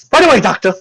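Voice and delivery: high, perky nurse character voice; obedient nurse. Acted. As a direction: pipe up brightly to the doctor. GM voices nurse answering the doctor.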